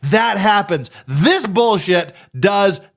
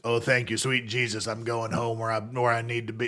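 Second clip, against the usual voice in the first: much lower, softer put-on voice, a mumbling mock of Griner; mocking impression of her voice; 9 semitones lower, 11 dB quieter than usual. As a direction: drop low and quiet, mocking how she talks. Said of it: "That's literally what she sounds like".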